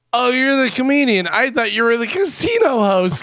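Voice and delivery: surprised woman. Retold or imitated. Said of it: "and she goes".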